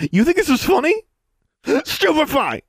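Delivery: very high-pitched, furious outburst; enraged shout. Acted. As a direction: shout it, then hurl the spell. Enraged attacker hurls a spell.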